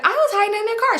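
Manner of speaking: high-pitched mimicked little-kid voice; Mari. Little girl explaining where she hid, high mimicry. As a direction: chirp it up high like a small child. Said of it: "Mari like".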